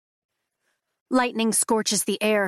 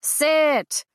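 higher, louder carrying command; 8 semitones higher than usual. Acted. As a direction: call it out over the crowd. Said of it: "he says loud enough for his voice to carry".

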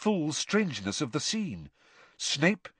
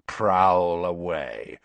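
low, slow, menacing growl; old auror threatening the teacher, low and slow; 5 semitones lower, 3 dB louder, much slower than usual. A narrator's reading. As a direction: let the threat sit in every word. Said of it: "his voice was full of menace".

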